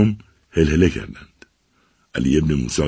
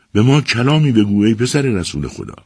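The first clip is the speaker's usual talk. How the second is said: raised, higher crowd plea; crying out; 6 semitones higher, 3 dB louder than usual. A narrator's reading. Crowd begging the Prophet's descendant to speak, calling out. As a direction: call out an eager request. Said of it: "چند تن با فریاد گفتند".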